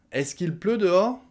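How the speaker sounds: "Est-ce qu'il pleut dehors?" asked high, in full form; question tune. Shown as a demonstration. Teacher spelling out the meaning, high questioning tune. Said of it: "ça veut dire".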